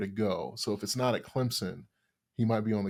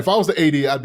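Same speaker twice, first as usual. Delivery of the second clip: loud, raised pitch, emphatic point; 5 semitones higher, 13 dB louder, faster than usual. Real, not acted. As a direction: push it up, insisting. Emphatic hypothetical as AD.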